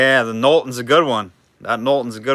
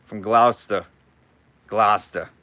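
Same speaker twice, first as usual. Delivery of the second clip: imitation Massachusetts accent, dropped r; Boston accent; 3 semitones lower than usual. Auctioneer imitating a Gloucester local, mock Boston accent. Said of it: "Love that accent".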